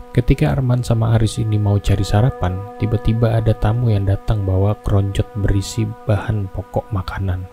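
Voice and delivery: ordinary delivery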